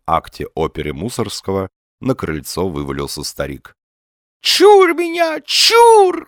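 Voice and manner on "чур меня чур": high, loud operatic wail; old man crying out with grand tragic flourish; operatic exclamation. A narrator's reading. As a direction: draw it out like an opera tragedian, pitched high. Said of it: "воскликнул он с шаляпинскими интонациями в голосе".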